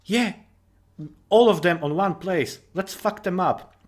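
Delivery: brash mock soldier grinning at the odds; cocky soldier. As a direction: play the gung-ho soldier who sees a chance. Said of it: "one guy is like".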